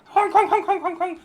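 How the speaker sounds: very high loud honking; silly clown honks. Acted. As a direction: honk like a clown horn. Honking like a goose.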